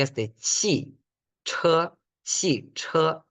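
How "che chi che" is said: che, chi, che said higher with Mandarin tones; Mandarin syllables. Shown as a demonstration. Teacher sounds out syllables for the class.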